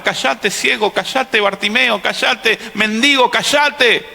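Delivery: high, insistent hushing of a beggar; silencing crowd. Acted. Crowd telling blind Bartimeo to shut up, insistent. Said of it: "todo el mundo lo hacía callar".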